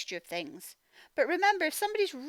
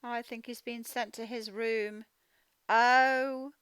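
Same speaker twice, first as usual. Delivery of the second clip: usual speaking voice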